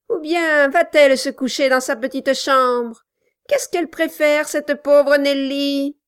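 amabilité forcée de nain; ton faussement aimable. A narrator's reading. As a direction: essaie de paraître charmant, sans y réussir. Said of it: "d'un ton qu'il croyait rendre agréable". (Quilp tente un ton agréable avec Nelly)

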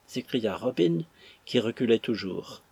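ordinary delivery

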